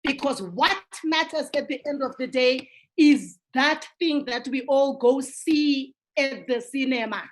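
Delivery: usual speaking voice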